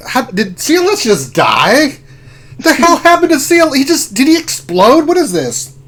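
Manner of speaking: high, loud, incredulous outburst; shocked disbelief. Retold or imitated. Player re-enacting his reaction to C L S dying, shocked. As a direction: re-enact a baffled, rising yell.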